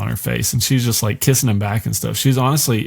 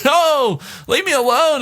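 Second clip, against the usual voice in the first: whiny mock plea. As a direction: whimper it like a bullied kid. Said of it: "And he's just like".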